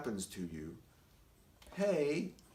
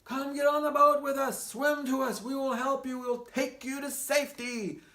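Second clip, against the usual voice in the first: high, loud call across the water; yelling from the boat; 9 semitones higher, 5 dB louder than usual. Retold or imitated. Boat people call to the stranded man, loud yell. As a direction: shout to the man on the roof to swim over. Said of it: "and people yelled".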